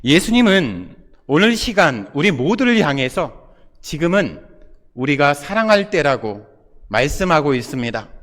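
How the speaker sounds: ordinary talk, nothing marked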